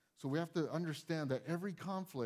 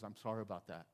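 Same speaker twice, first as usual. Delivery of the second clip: soft apology; 7 semitones lower, 7 dB quieter, faster than usual. Retold or imitated. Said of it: "I said".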